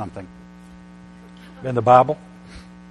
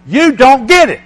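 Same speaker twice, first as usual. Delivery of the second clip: loud, high voice of God rebuking; God's rebuke, loud and high; 12 semitones higher, 14 dB louder than usual. Retold or imitated. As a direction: speak as God cutting in sharply. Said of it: "And now God's saying to me".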